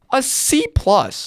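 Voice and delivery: slow, high, stretched out; squeaky disbelief. Real, not acted. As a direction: say it like you can't believe it. Mock-shocked grade reveal.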